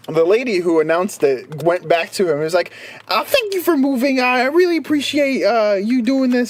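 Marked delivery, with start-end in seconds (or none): 3.1-6.5 sweet attendant voice. Retold or imitated. Voicing the flight attendant.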